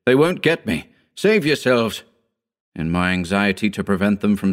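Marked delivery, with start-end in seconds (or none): 0.0-2.0 higher, insistent mild shout. A narrator's reading. Narrator telling friends to save themselves, mildly shouted. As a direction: urge them away in a contained shout. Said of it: "I shouted".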